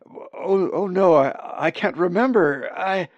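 high, loud, frightened stammer; panicked stammer. Acted. Hurt raven realizes he can't remember his name, panicked. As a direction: break into panic over a lost name. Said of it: "bill began to chatter".

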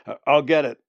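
loud, stammering shout. A narrator's reading. Man shouts that he will handle the bird, flustered. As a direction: call out loudly, tripping on the first word. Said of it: "the other one shouted".

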